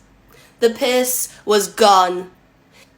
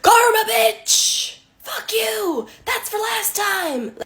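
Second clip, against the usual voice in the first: pretend shout; 13 semitones higher, much slower than usual. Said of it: "Took all me strength not to be like".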